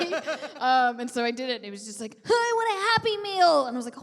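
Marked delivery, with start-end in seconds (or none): 2.3-3.7 put-on higher, louder little boy. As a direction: speak like an eager little boy. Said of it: "Can you do a boy voice?".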